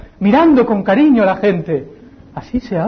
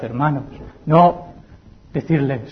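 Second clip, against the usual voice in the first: normal talking voice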